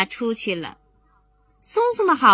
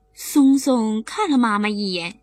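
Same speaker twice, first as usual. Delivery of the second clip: ordinary delivery, nothing marked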